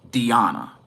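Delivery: "Diana" said dee-AH-na, with ee and ah. Shown as a demonstration. Reviewer correcting own pronunciation of the promotion, "Diana" as dee-AH-na. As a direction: say "Diana" with a long ee, then a stressed ah. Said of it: "wanted to go ahead and correct".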